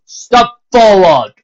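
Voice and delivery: loud, high, slow proclamation; stern captain. A narrator's reading. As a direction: lay down the law to the crowd. Captain calls out.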